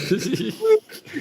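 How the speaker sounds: very high squealing laugh; shrieking laugh. Real, not acted. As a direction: burst into a high cackle. Laugh at snowboard story.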